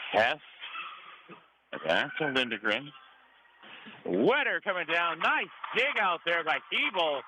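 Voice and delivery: ordinary talk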